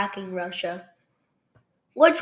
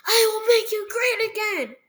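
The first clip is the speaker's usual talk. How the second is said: much higher put-on voice for the mustached leader; mock dictator; 11 semitones higher than usual. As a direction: ham up a pompous promise. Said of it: "a funny guy with a mustache says".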